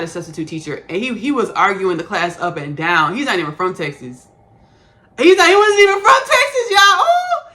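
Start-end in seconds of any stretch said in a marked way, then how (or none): 5.2-7.5 loud, very high-pitched squeal of indignation; indignant outburst. Real, not acted. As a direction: cry it out high and loud, scandalized. Indignant outburst about the substitute.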